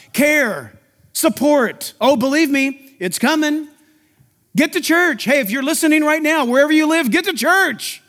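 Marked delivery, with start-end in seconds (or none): none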